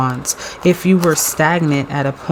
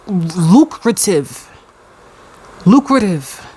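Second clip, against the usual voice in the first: lucrative stretched very slow and rising; drawn-out emphasis; 3 semitones higher, much slower than usual. Real, not acted. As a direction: savor the word lucrative slowly. Dramatic theme announcement.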